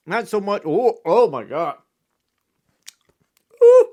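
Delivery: wincing at the sour hit; eating sour candy. Real, not acted. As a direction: gasp through a sour mouthful. Sour candy hits hard.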